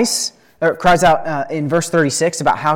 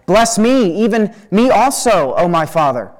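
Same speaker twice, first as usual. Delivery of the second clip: higher, louder pleading cry, as narrated; bitter cry; 6 semitones higher, 6 dB louder than usual. A narrator's reading. Esau begs his father for a blessing, crying out bitterly. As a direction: cry out to be blessed in a dramatic reading. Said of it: "he cried out with an exceedingly great and bitter cry".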